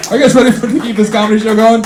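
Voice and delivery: pumped-up host, high and loud; rally the crowd; hyped MC. Real, not acted.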